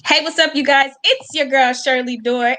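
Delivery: normal talking voice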